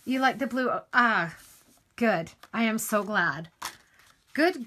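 ordinary talk

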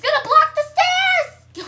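high, loud, drawn-out scream; high-pitched alarm; panicked shout. Real, not acted. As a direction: scream the warning out. Panicking at blocked escape.

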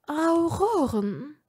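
slow, rising disbelieving repeat of Auroren; slow questioning repeat. A narrator's reading. Lucius repeating the servant's news, slow and doubtful. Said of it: "wiederholte Lucius".

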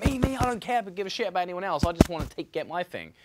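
mocking selfish taker. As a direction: sneer it like someone who only wants to take. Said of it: "It's like".

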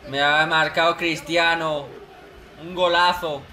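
ordinary delivery

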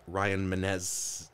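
ordinary delivery

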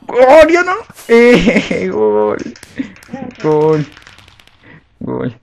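loud high shout with a laugh; excited shout. Real, not acted. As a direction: yell out at the goal. Sister scores, loud reaction. (grito emocionado)

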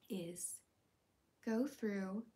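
ordinary talk, nothing marked

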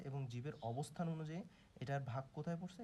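normal talking voice

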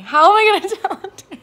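loud, high, fast with a laugh; excited laughing. Real, not acted. Wondering how to tell Danny, excited and laughing. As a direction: burst out giddy and rushed. Excited about telling partner.

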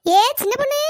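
shouting after her. Acted. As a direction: yell it so she can't pretend. Repeated call, ignored again.

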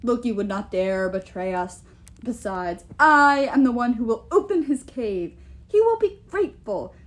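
imperious giant with loud, swelling emphasis; boastful giant king. Acted. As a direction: boast like a king sure of his power.

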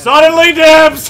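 high, loud silly battle cry; shouted catchphrase. Pretend. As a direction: yell it like a war cry.

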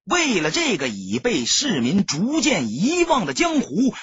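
ordinary delivery

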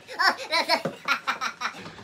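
real burst of laughter; big laugh. Real, not acted. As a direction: crack up laughing. Laughs at the joke.

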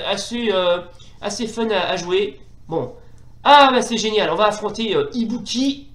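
normal talking voice

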